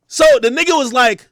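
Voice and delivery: ordinary delivery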